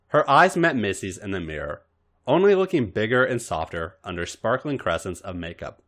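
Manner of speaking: usual speaking voice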